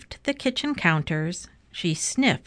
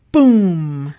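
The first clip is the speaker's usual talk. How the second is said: loud, drawn-out boom; big storybook sound effect; booming crash; 9 dB louder than usual. A narrator's reading. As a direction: boom it out like a collapse.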